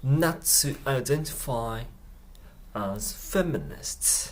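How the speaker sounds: drawn out slowly with feeling; slow each word down and mean it; very slow, heartfelt. Shown as a demonstration. Teacher modelling the clip for learners, slow and heartfelt. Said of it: "すごくゆっくり 感情を込めて言ってますね".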